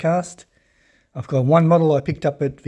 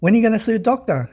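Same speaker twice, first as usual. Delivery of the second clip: high, quick mock nagging wife; imitated nagging; 8 semitones higher, 4 dB louder, much faster than usual. Husband imitating his wife repeating herself, high-pitched nagging. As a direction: pester in a raised, hurried imitation of a wife. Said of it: "Wife's always on my back".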